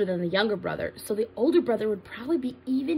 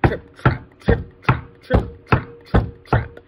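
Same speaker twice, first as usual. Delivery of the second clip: loud, heavy trip-trap; big goat hoofsteps; 7 dB louder, much slower than usual. Acted. Big goat starts across the bridge, loud hoofsteps. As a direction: stomp out each word percussively.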